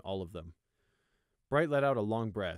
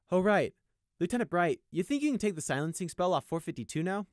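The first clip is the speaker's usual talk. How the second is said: higher, louder call to the officer; 9 semitones higher, 5 dB louder than usual. A narrator's reading. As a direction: call over to the lieutenant. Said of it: "he called out".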